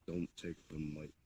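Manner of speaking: ordinary talk, nothing marked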